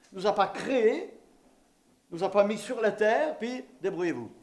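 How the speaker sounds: normal talking voice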